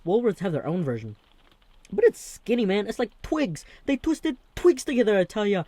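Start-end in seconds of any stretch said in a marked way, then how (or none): 1.9-5.6 high, loud mock-outrage about skinny pastry; emphatic. Real, not acted. Vlogger to viewers, high loud mock outrage. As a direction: squeal it like it's ridiculous.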